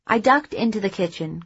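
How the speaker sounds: usual speaking voice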